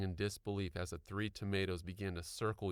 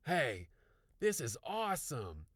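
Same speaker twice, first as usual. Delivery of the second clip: excited cry; 6 semitones higher than usual. A narrator's reading. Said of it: "Zach cried".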